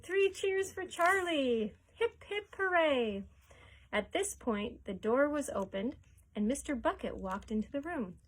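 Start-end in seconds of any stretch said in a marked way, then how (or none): 0.0-3.2 high, slow, loud cheer. A narrator's reading. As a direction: lead the room in a cheer. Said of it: "he shouted".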